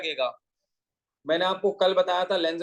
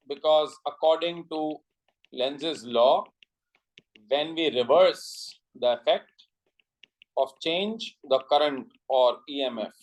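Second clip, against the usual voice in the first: very slow dictation in short chunks; measured note-giving with long gaps; 3 semitones lower, much slower than usual. Real, not acted. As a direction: give the notes out slowly, phrase by phrase. Dictates notes to the class.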